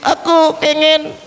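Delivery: high puppet voice breaking into wails; hammed-up puppet weeping; mock crying. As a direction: pretend to sob between the words. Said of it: "Kenapa kamu kok nangis?".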